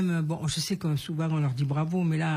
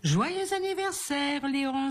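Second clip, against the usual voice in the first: high, drawn-out birthday greeting; joyful cry; 7 semitones higher, much slower than usual. A narrator's reading. Elf greeting Léon on his birthday, crying out joyfully. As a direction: sing out the elf's merry greeting. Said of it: "s'écria le lutin".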